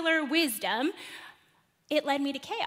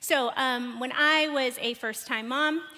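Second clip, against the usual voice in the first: ordinary talk, nothing marked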